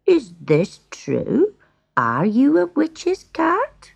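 slow, careful question lifting at the end; gentle. A narrator's reading. Woodcutter questions the cat, gentle and slow. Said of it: "spoke to him gently".

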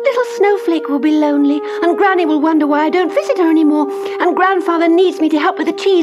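put-on high, fast, desperate young girl; pleading little girl. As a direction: beg to be let go home. Heidi pleads to go home.

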